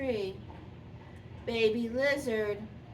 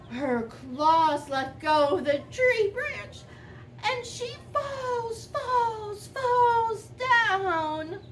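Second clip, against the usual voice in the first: rising dramatic fall; 8 semitones higher, 4 dB louder, slower than usual. A narrator's reading. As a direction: voice climbing high as she falls. Lizard falls, voice rises.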